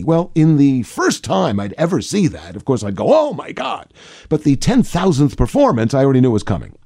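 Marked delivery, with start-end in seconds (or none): 3.1-3.9 acted surprise. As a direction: react as if seeing it for the first time. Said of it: "remind myself to be surprised".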